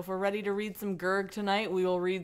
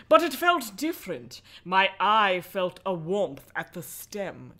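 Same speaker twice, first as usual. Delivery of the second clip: story narration. A narrator's reading. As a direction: linger on the strangeness. Reads the horror book aloud.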